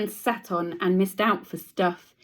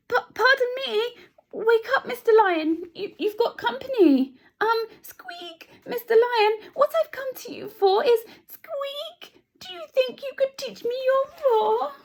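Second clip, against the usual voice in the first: very high, stammering and nervous; timid squeaky mouse; 3 dB louder than usual. Acted. Teacher voicing the frightened mouse asking the lion for a roar. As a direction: squeak it out, scared of waking him.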